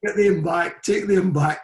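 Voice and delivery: hammed-up furious order; imitating angry gaffer. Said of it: "He says".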